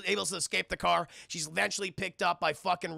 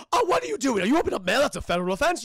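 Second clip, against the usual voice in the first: loud, alarmed objection, starting high; hammed-up outraged official; scolding postman; 6 semitones higher, 8 dB louder, faster than usual. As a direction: burst out indignantly at the culprit. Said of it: "And the postman's like".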